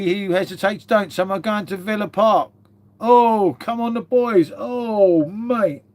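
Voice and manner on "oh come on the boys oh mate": higher and louder rallying cry; excited cheer. Real, not acted. Cheering Spurs fans going to Villa Park, excited rallying cry.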